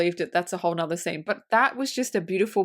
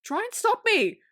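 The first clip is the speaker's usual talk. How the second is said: much higher, hammed-up desperate voice; mock frantic demand; 12 semitones higher than usual. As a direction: plead in comic panic to be stopped. Acts out Hook's frantic demand.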